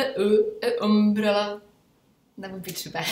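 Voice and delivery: "e, e, umbrella" hammed up with a broken gap; mock wrong article. Shown as a demonstration. Teacher mocking the wrong "a umbrella". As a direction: stumble over the vowel on purpose. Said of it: "protože říkat e".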